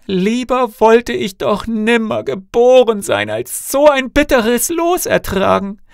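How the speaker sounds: high, loud cry of despair; anguished wailing lament. A narrator's reading. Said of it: "rang die Hände und rief aus".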